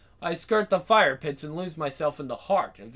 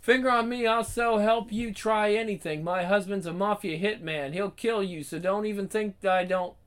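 gangster's moll; 8 semitones higher than usual. Acted. Tough woman reads her threat.

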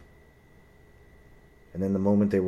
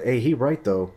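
a touch higher and louder mock taunt; teasing guy in line; 5 semitones higher, 6 dB louder than usual. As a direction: needle him in a lightly voiced bro tone.

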